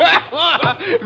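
high, wild taunting laugh; high-pitched jeering laughter; mocking cackle. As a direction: cackle gleefully at a cornered victim. Gunman mocks the constable.

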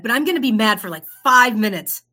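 loud, indignant declaration of a sulk; mock mad. Telling herself she'll be mad, playfully loud. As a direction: announce your short tantrum loudly. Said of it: "I kinda got mad".

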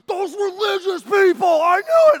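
high, very loud, slow angry shout; imitating an enraged outburst; mad. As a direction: roar it out in hammed-up outrage. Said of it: "Oh, he's mad again".

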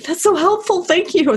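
grateful gush. Retold or imitated. Retelling her own grateful reply.

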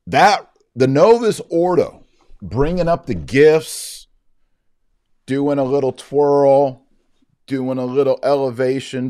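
high, loud mock-solemn send-up of the rite. Vlogger mocking the Novus Ordo offertory to viewers, high and loud mock-solemn. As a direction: ham up the gestures like a parody.

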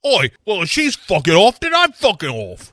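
high, loud mock cockney; cockney quitting. Second Spice Girl to Clifford, loud mock cockney. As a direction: flounce off in put-on cockney.

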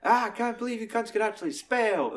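high, contemptuous killer impression; mock the cops from up high; Kevin Spacey's killer voice. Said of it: "he's doing an accent".

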